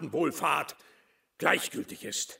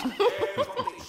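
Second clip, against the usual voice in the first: laugh. Acted. Laugh amid speech.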